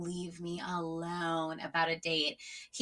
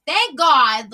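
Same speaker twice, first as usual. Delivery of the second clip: loud, high, drawn-out exclamation; retold relief; 4 semitones higher, 10 dB louder than usual. Retelling her reaction of relief.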